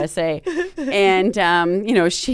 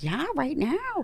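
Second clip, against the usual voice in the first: slow, soft, higher mock-doctor reply; matter-of-fact doctor; 7 semitones higher, 9 dB quieter, slower than usual. Doctor answering panicked patient, soft matter-of-fact reply. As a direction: imitate the doctor confirming it, unhurried and quiet. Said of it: "And she's like".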